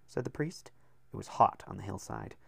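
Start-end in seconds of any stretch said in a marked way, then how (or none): none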